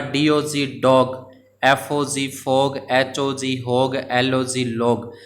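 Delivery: slow spelling out. Shown as a demonstration. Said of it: "तो इन पे circle करना है".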